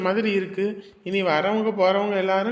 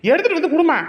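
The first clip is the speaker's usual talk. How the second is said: much higher, louder, pleading at the end of his patience; exasperated demand; 10 semitones higher, 6 dB louder than usual. Acted. Son yelling at his mother to hand over the money, exasperated. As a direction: snap at her to just bring the money.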